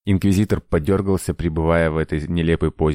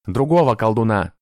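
higher-pitched, irritated bark; angry bark; 5 semitones higher than usual. A narrator's reading. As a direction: bark a correction at the underling. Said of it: "рявкнул инквизитор". (рявкнув)

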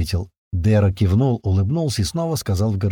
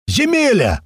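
high, drawn hail to a countryman; joyful yell; 13 semitones higher, 3 dB louder, slower than usual. A narrator's reading. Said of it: "заорал Страйк".

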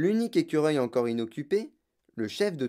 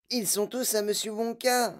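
high-pitched motherly voice; placating mother; 8 semitones higher than usual. A narrator's reading. Mother refusing her daughter, placating. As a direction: reason with her in a higher voice.

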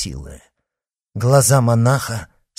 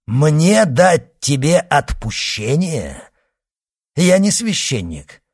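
scornful outcry; 7 semitones higher, 6 dB louder than usual. Acted. Monk reveals he is no priest, scornful cry. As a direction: throw it back loudly, with scorn.

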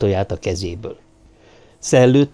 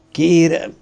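raised, strained single word; dying groan; 6 semitones higher than usual. A narrator's reading. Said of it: "nyögte el haló hangon".

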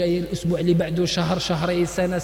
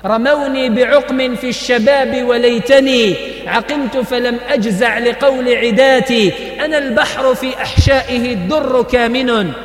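slow, louder chanted verse; poem recital; 4 semitones higher, 5 dB louder than usual. Acted. Preacher reciting Hafez Ibrahim's poem to the congregation, slow and loud. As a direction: declaim each line slowly with weight. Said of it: "كاين كلام العربي".

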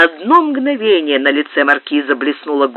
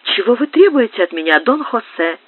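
a higher, anxious woman's voice; lightly voiced countess; 7 semitones higher than usual. A narrator's reading. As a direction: ask him, frightened. Графиня спрашивает маркиза. (графиня, испуганно и выше)

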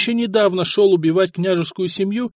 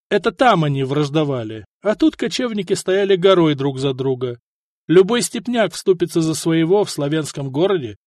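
ordinary delivery, nothing marked

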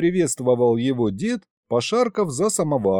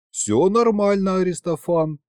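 ordinary delivery, nothing marked

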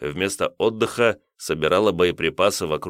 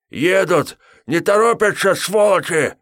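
furious warning shout; 12 semitones higher, 6 dB louder than usual. A narrator's reading. Bloodied driver yells warning.

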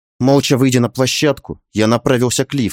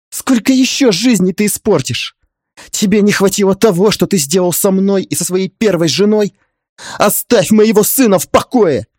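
higher, louder angry exclamation; mother's outcry; 11 semitones higher, 5 dB louder than usual. A narrator's reading. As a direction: shout to defend her son. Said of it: "возглас матери". (громко, возмущённо выкрикнуть)

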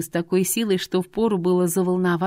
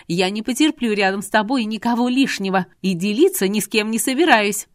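louder, heated woman's voice; barked demand; 3 semitones higher, 5 dB louder than usual. Acted. Irina refuses to share Artur with anyone, barking. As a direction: insist forcefully, pitched up. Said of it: "рявкнула Ирина".

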